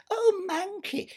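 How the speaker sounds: high, slow syrupy simper; a sickly-sweet imitation of Cinderella; mock Cinderella. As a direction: mimic the sweet stepsister, sugary. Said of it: "she'd say to me".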